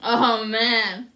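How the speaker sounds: normal talking voice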